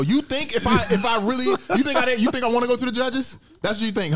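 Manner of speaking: high, heated, disbelieving questions; worked-up rant. Real, not acted. Fighter fan ranting at critics who blame the fighter, high heated disbelief. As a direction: pitch it up in outraged disbelief.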